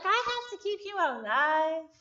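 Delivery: ordinary talk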